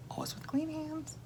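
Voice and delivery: quick soft whisper, almost unvoiced; whispered aside. Real, not acted. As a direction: drop to a secret whisper for the aside. Whispered aside about salt.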